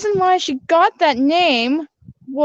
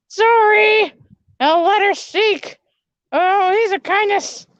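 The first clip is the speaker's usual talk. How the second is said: high princess voice, sheepish; a chastened princess owning up; apologetic princess; 6 semitones higher, 3 dB louder than usual. Acted. Speaker voices Princess Eve character.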